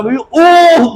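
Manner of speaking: high, loud fan's whoop; excited shout. Retold or imitated. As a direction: whoop like a fan at a goal. Son reacts to football match. (grito de hincha emocionado)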